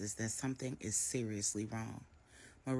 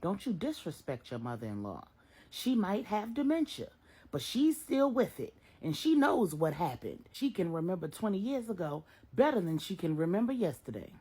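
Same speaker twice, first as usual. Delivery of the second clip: louder, stern warning; scolding mother; 6 semitones higher, 5 dB louder than usual. A narrator's reading. Miss Brenda scolds Marcus for disrespecting his mother-in-law. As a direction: put him in his place.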